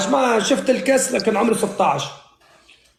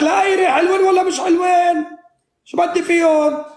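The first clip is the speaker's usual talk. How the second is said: shouting; 6 semitones higher than usual. Real, not acted. Excited outburst at the game.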